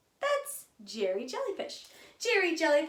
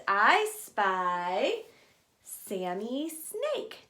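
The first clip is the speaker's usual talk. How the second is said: slow reveal; 3 semitones lower, much slower than usual. Acted. I spy game.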